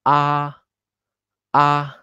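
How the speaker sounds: "ah" held high and even; high level tone. Shown as a demonstration. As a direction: sustain "ah" on one high, unbending pitch. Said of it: "tone one is always very high".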